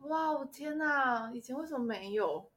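slow, high, drawn-out wow; amazed. Retold or imitated. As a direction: marvel at it slowly. Amazed by the garment steamer.

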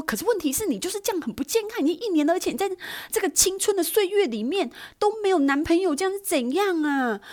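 pushy friend scolding in a high voice; pushy girlfriend. Retold or imitated. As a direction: lecture her friend like a know-it-all. Friend B lecturing friend A.

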